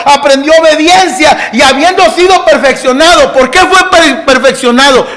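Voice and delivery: full-force pulpit preaching; fiery preaching. Acted. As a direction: preach it to the back row.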